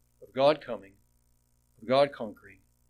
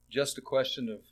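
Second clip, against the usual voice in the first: normal talking voice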